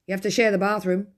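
blurted fast to head off the guest; quickly. Acted. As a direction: hurry to get it out first. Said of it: "said Mister Murgatroyd quickly".

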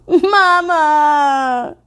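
put-on high, loud wail of a little girl; wailing child. A narrator's reading. Little girl wailing for her mom after a threat, mild wail. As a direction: cry out for mama like a small child. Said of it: "wailed Little Pigtails".

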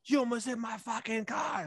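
high mock shriek; raging woman, high-pitched mock yell; angry lady. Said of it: "she's going off".

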